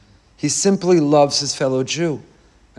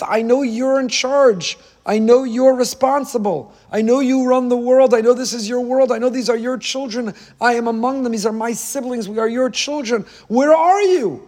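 raised-pitch plea, climbing to a cry; Moshe pleading with God; 6 semitones higher than usual. Acted. Moshe crying out to God for his suffering people, pleading. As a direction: beg God to show himself. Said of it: "when you say, "Where are you?".

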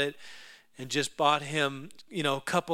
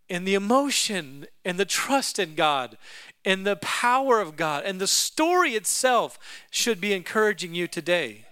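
usual speaking voice

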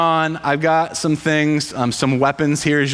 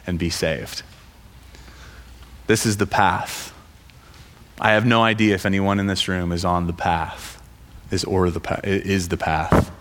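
lower, earnest sermon voice; preaching; 9 semitones lower than usual. Acted. Preaching to the congregation.

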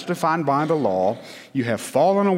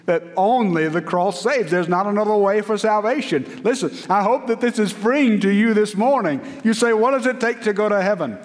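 impassioned preaching; 7 semitones higher than usual. Acted. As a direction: preach it out with fire to the congregation. Preaching at full force.

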